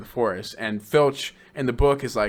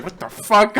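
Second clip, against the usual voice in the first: loud, high, rising disbelief; mock shock; 8 semitones higher, 5 dB louder, slower than usual. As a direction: act out a startled, baffled reaction. Acts out Harry's shocked reaction.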